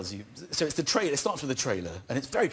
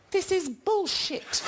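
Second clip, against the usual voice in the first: put-on slow, much higher little-boy voice, laughing; the son staring into camera, fed up; kid's deadpan swear; slower than usual.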